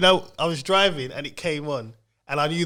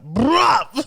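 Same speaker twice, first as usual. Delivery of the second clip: high, loud exclamation; surprised bruh; 10 semitones higher, 4 dB louder than usual. Retold or imitated. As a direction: blurt it out in shock. Reacting to a surprise song.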